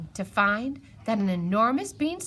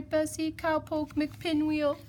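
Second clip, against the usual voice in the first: ordinary talk, nothing marked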